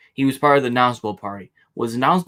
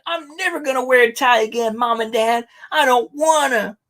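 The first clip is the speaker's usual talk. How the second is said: put-on much higher, whiny childish voice; whining kid; 11 semitones higher than usual. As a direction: whine at mom and dad like a sulking boy. Imitating whining kid to parents.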